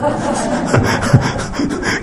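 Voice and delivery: soft airy laugh at his own joke; quick unvoiced chuckle; a little breathy laugh after the punchline. Real, not acted.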